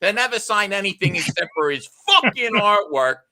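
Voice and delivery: hammed-up self-important son; lecturing son. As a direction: scold the fan on his father's behalf. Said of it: "his son goes".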